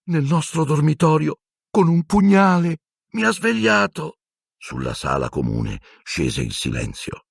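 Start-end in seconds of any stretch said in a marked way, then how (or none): 0.0-4.2 high, urgent frightened voice; frightened boy. Acted. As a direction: insist in panic. Ron frightened.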